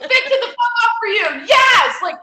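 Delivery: high, loud, laughing team-player enthusiasm; hammed-up shouted eagerness; mock eager shout. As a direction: oversell it like a gung-ho cheerleader. Exaggerated eager salon owner.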